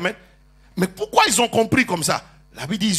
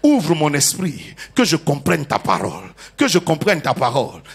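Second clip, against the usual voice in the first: prayer for the congregation to repeat; 8 semitones lower than usual. Real, not acted. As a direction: lead the church in a spoken prayer. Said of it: "Dis avec moi".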